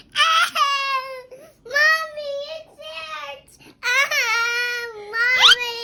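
high, loud pretend cry of pain; child acting a cut finger, calling Mommy; hurt crying. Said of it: "and they will say".

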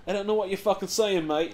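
put-on British accent. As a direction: imitate a British girlfriend, lightly British. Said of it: "like, an extra British accent".

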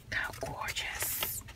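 slow, soft whispered wonder; awed whisper. Real, not acted. Admiring a 1906 magazine cover, whispering. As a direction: breathe it out in a whisper.

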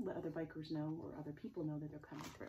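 usual speaking voice

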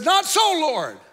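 high shouted refusal dropping on the name; Peter refusing. Acted. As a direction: refuse the Lord loudly. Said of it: "He said".

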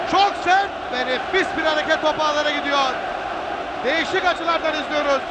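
normal talking voice

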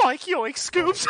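Shaggy voice. Retold or imitated. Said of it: "you sounded like Shaggy from Scooby-Doo".

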